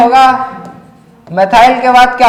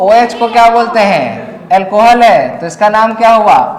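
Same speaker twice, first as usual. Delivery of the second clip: usual speaking voice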